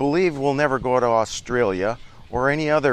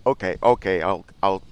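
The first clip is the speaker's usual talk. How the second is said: ordinary delivery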